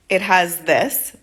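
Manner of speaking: very slow, broken beginner phrases; slow simple words. Retold or imitated. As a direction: spell it out word by word like a learner. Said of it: "I said, in Portuguese".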